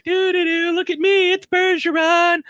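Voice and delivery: a carefree fan posing for the crowd; oblivious girl. Retold or imitated. Girlfriend vamping for the crowd, mock singsong. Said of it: "she's just oblivious".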